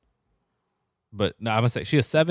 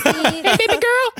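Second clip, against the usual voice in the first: very high, loud squeaky falsetto; big guy with a comically squeaky voice; pipsqueak voice; 9 dB louder, much slower than usual. Acted. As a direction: squeak it out in a tiny, piercing man's voice. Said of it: "he has a pipsqueak ass voice".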